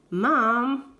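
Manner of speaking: a whiny, drawn-out call for mum; whiny little brother. Retold or imitated. Little brother whines to mum after the nose flick. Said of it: "in this really whiny brother way".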